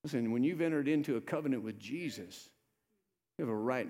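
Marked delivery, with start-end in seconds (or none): none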